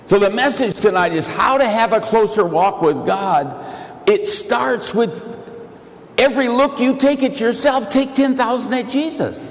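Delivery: full-force pulpit preaching; fervent preaching. Acted. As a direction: preach it out to the crowd.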